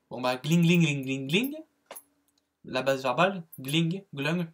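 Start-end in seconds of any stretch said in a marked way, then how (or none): 0.4-1.6 alarm clock ringing. Shown as a demonstration. Teacher repeating the memory trick, imitated alarm ringing. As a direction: chime gling over and over like an alarm. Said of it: "il fait du bruit".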